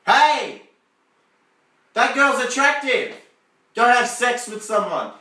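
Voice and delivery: loud, high calling out; tempting voice, loud. Acted. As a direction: call out insistently as a tempter. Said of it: "you won't hear that voice saying".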